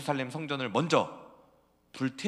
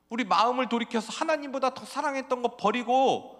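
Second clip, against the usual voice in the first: loud, high prophet calling out; prophet's cry; 8 semitones higher, 6 dB louder than usual. Acted. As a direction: call the people back to God at full voice. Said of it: "그래서 계속 외쳤죠".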